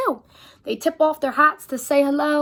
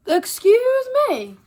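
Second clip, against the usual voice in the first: indignant; 8 semitones higher, 5 dB louder, slower than usual. Acted. Reacting to eagle's rudeness.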